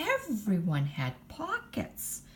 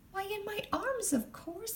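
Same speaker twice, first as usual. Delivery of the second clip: Missus Monkey voice; 13 semitones higher, 7 dB quieter than usual. Acted. Missus Monkey answers primly.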